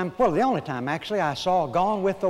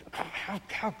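hushed, higher stammer; shocked disbelief; 5 semitones higher, 8 dB quieter than usual. Acted. Preacher voices shocked people hearing of horrors, hushed stammer. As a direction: act lost for words, much softer. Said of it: "we're shocked at when we hear".

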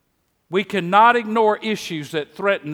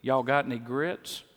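put-on Mississippi accent; 5 semitones lower, 7 dB quieter than usual. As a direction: ask for grits in a lightly imitated Mississippi accent. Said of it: "with my Mississippi accent".

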